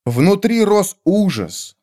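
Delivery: ordinary delivery, nothing marked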